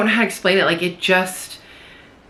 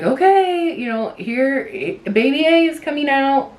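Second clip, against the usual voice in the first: much higher, bright announcing nurse voice; mock nurse happily calling the birth; cheery nurse; 8 semitones higher than usual. Nurse announcing baby coming out.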